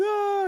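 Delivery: very high mock scream; girl screaming. Trapped girlfriend screams below the stairs, high mock scream. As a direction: yelp like the terrified girl at the stairs. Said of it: "crying and everything, screaming".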